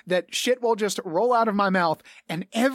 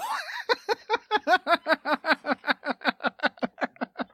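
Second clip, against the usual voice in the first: a long, high, delighted laugh; giddy high laugh; 12 semitones higher than usual. Real, not acted. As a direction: laugh at how well the bluff worked. Laughing at own luck as DM.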